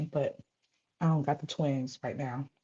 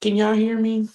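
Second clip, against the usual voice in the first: higher, louder check-in call; host calling out to viewers after phone call, louder; 6 semitones higher, 6 dB louder than usual. Real, not acted. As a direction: call out to see if anyone hears. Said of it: "Please tell me y'all can hear me".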